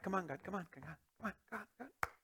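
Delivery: soft, hurried under-breath come ons; half-whispered impatient nagging; mock impatient plea. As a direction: mutter impatiently at heaven. Impatient plea to God retold.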